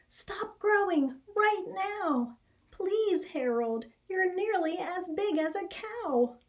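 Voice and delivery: a worried mother's high plea; pleading high mother voice. Acted. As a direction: beg him to stop growing, way up high. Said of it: "cried his mother".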